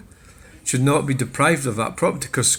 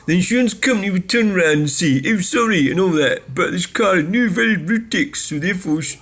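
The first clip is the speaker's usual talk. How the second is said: higher, smug know-it-all read; mocking Brian, pitched up; mock commenter voice; 6 semitones higher, 3 dB louder than usual. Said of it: "he says".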